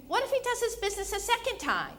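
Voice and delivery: high, quick challenge; pressing question. Acted. Wife grills husband about the dog walk, pressing. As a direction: press him quickly, pitched well up.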